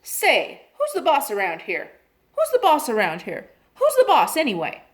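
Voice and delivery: a cheeky parrot yelling at everyone. A narrator's reading. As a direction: shout down the room. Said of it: "Penelope yelled out".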